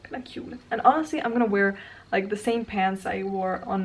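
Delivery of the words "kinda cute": soft whispered compliment to herself; hushed self-praise; whispered approval. Real, not acted. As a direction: whisper it, pleased. Approving her hairstyle.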